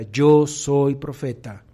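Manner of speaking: slow, firm "Yo soy profeta" with weight on "Yo"; slow declaration. Shown as a demonstration. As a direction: say it slowly, as a claim you stand behind. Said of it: "dilo de la siguiente manera".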